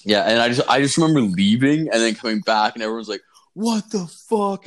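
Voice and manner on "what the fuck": high, drawn-out alarmed outcry; crowd freaking out. Retold or imitated. Partygoers discovering puke in their shoes, freaking out. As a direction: imitate a shocked partygoer, pitched way up. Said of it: "and freaking out".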